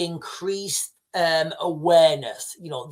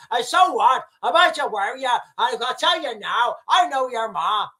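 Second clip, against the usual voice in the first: slightly raised Irish tough-talk; cheeky threat in a Northern Irish accent; mock Irish accent; 4 semitones higher than usual. Slips back into Irish voice.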